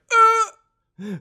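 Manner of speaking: high strangled gasp; mock choking gasp; choked gasp. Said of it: "I was like".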